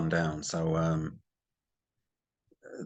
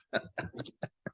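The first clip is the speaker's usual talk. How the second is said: self-mocking laugh; 12 dB quieter than usual. Real, not acted. Laughing at own unprofessionalism.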